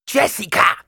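hoher, lauter Greisinnenschrei; kreischend. A narrator's reading. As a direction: schrill gekreischter Name. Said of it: "kreischte die alte Frau". (alte Frau kreischt nach Jessica)

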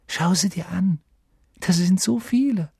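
high, eager urging; eager wonder. A narrator's reading. Harry shows Ron his family in the mirror, eagerly amazed. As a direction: lift the voice in amazement.

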